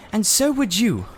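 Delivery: put-on high, upset boyish voice; a boy near tears lashing out; miserable boy. A narrator's reading. Diggory, miserable, snapping back at Polly. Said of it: "so miserable that he didn't".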